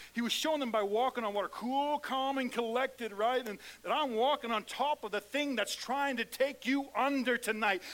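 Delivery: loud, rising preacher's declaration; preaching at full force. Acted. Pastor preaching authority to the congregation, full force. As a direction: proclaim it to the whole room.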